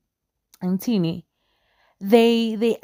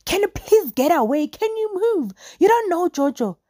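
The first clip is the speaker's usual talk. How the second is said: high bossy mock voice, much higher pitched; mocking Maria; 10 semitones higher than usual. Maria pushing Sane away from Jojo, high bossy mock. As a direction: shoo people off like a possessive friend. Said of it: "Maria's like".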